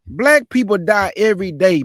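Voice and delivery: loud, forceful outburst; angry rebuke. Real, not acted. Commentator rebuking a man crying on camera, angry and loud. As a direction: cut in loudly, indignant.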